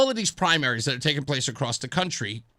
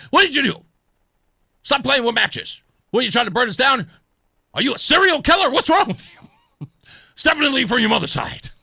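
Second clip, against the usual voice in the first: higher, louder mock tirade at a son; accusatory yelling; 6 semitones higher, 6 dB louder, faster than usual. Father accusing his matches-playing son, loud and angry. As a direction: keep scolding, louder and wilder. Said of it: "You start being, you know, accusatory".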